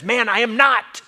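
high, loud, emphatic protest; indignant denial. Acted. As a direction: deny it hotly at full voice. Acting Peter's second denial.

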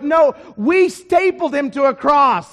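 loud, high, emphatic rebuttal; scornful Roman retort. Acted. Roman rejecting Jesus as Lord, loud and scornful. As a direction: thunder back as an outraged Roman. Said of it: "The Romans would reply with".

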